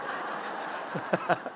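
quick unvoiced laugh at his own joke; breathy laugh. Real, not acted. Preacher laughing after his joke about the tube. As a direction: let a soft laugh slip out.

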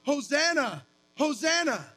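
lifted joyful crowd cry; crowd's cry. Retold or imitated. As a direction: cry it out like the palm-waving crowd. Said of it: "they were crying out".

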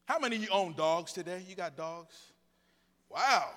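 usual speaking voice

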